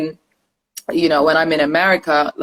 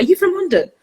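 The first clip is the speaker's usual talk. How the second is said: curious Americans; 13 semitones higher, faster than usual. Retold or imitated. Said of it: "they'll be like".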